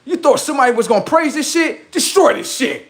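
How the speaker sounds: high fired-up rant. Acted. High ranting.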